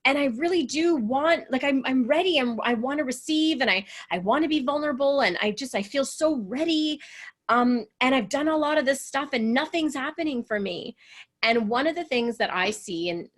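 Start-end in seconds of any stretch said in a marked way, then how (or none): 0.0-11.0 higher, pleading retelling of a friend's complaint; frustrated girlfriend. Retold or imitated. Coach imitates her frustrated girlfriend for Gail, higher and pleading. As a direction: voice the friend who feels ready but stuck, a little higher. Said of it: "'cause she's like".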